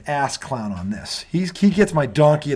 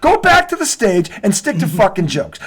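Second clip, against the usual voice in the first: higher and louder furious tirade; angry rant; 8 semitones higher, 5 dB louder than usual. Real, not acted. Host rants at Bill Burr over Belichick, higher and louder. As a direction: tear into him, voice rising.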